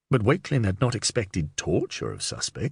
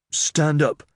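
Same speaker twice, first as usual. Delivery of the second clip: raised, higher-pitched order; sharp command; 5 semitones higher, 3 dB louder than usual. A narrator's reading. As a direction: order him to his feet. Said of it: "commanded Wakelin".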